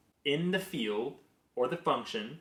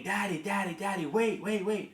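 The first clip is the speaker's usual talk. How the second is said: high-pitched imitation of a small child calling out; little daughter; 8 semitones higher than usual. Toddler running down the hallway to Daddy, imitated urgent. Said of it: "I hear her running down the hallway saying".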